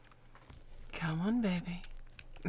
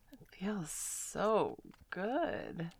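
drawn-out breathy whisper; unhurried intimate whisper; slow whisper; 2 semitones higher, much slower than usual. Acted. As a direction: let it out slowly in a whisper.

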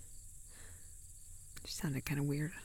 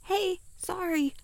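flustered; 12 semitones higher, 7 dB louder than usual. Acted. Answering walkie-talkie, caught out.

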